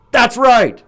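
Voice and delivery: high loud satisfied; mock smug approval. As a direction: approve like a bully. Character approves.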